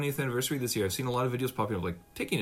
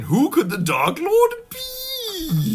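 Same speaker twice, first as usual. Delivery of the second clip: high, slow, booming mock-ominous question; slow, high, loud spooky question; mock dramatic trailer; 8 dB louder, much slower than usual. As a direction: ham up a dramatic movie reveal. Mocking dramatic movie trailer line.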